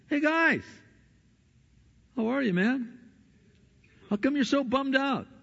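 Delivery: high-pitched, casual mock greeting; playful Jesus greeting. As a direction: chirp it as an innocent newcomer. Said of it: "When Jesus comes up and says".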